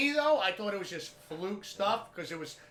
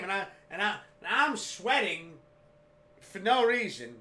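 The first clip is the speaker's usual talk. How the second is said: normal talking voice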